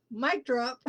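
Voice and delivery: says it through a laugh. Real, not acted. Host cracking up at the card's message, laughing. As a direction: giggle out the words.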